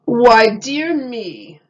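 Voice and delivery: a slow, booming wise man. A narrator's reading. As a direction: pronounce it like a sage.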